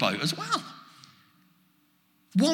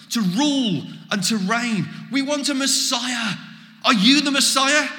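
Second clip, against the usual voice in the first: higher, excited outpouring of wishes; imagined eager disciples; 6 semitones higher than usual. Pretend. Disciples finally asked by Jesus what they want, excited. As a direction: pile up the hopes eagerly. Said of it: "they could have gone".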